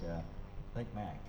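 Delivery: ordinary talk, nothing marked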